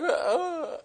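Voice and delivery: high, soft wordless whimper; mock tearful mumbling. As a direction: imitate a crying woman's muffled mumble. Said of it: "kind of mumbling in her voice".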